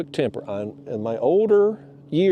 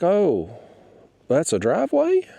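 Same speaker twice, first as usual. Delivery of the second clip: lightly voiced neighbor, higher, innocent "oh really?" tone; mock surprised neighbor; 5 semitones higher than usual. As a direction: play dumb like the neighbor caught out.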